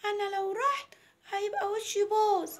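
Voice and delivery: put-on high, slow child voice; refusing little girl. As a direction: refuse as a small girl, dragging it out. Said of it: "قالت لها". (صوت طفلة رافضة)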